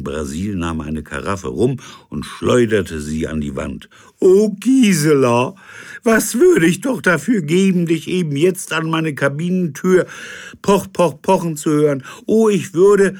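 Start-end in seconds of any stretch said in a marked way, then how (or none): 4.2-13.2 high, loud pining sea captain; lovesick old captain. Acted. Drunk captain longs for Gisela, high and loud. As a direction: yearn for her theatrically.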